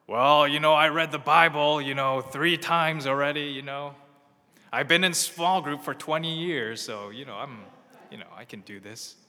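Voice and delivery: loud, self-satisfied bragging fading off; smug overconfident believer. Acted. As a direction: brag about your Bible credentials.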